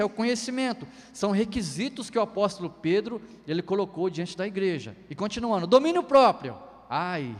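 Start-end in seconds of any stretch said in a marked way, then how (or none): 5.7-6.6 domínio próprio called out loud and high; loud announcement. Acted. Preacher announcing the next virtue, loud proclamation.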